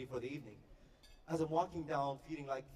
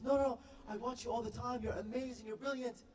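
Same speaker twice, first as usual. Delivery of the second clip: put-on high-pitched gushing girl fan; starstruck British girl; 7 semitones higher than usual. British girl praising the YouTube guy, high starstruck voice. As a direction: gush in a high girl's voice. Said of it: "she's shaking, she's sweating".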